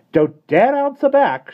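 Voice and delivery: very high, loud, slow threat; nanny warning the boy. A narrator's reading. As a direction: warn him off. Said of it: "she said warningly".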